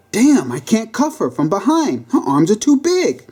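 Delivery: exasperated struggling cop; frustrated cop. Acted.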